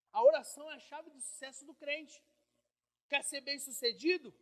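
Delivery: loud preaching. Acted. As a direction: declare it to the church. Preaching at full force.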